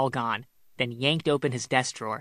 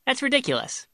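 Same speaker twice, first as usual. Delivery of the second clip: much higher, mock-amused denial; forced amusement; 12 semitones higher than usual. A narrator's reading. Son-in-law denying he is jealous, forced amusement. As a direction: brush it off in a high, forced-light voice. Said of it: "Ben forced a laugh".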